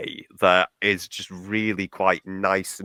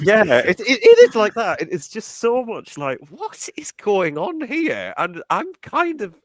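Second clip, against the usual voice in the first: high, loud amazed outburst; can't believe what you're watching; excited disbelief; 11 semitones higher, 7 dB louder than usual. Real, not acted. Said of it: "delighted disbelief".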